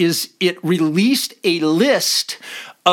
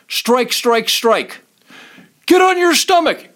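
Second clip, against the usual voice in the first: a cop roaring orders at a suspect, retold; officer shouting orders; 7 semitones higher, 4 dB louder, slower than usual. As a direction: command shouted high and loud. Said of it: "I saw these officers".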